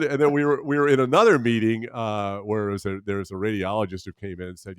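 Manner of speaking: normal talking voice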